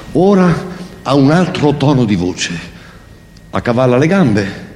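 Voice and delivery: ordinary talk, nothing marked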